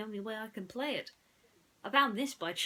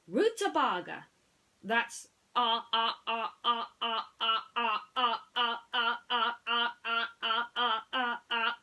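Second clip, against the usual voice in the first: higher, loud stuttering electronic toy; stuck robot voice; 4 semitones higher, 8 dB louder than usual. Acted. Mister Spell glitches while spelling, robotic stutter. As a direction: repeat like a glitching machine.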